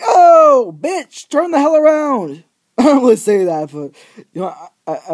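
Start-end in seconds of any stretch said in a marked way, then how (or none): 0.0-2.4 frustrated yell. Retold or imitated. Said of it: "I'm like".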